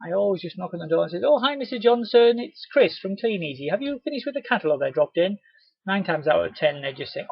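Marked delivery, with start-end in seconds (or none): 1.2-5.4 high, bright friendly hello; cheery doorstep greeting. Shown as a demonstration. Rep knocking to collect a catalogue, cheery. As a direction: greet the customer brightly at the door.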